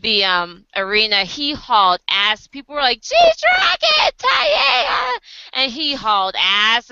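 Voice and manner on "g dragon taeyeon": high shrieking fangirl yell; mock screaming fan voice; fans screaming names. Fans screaming after fleeing idol.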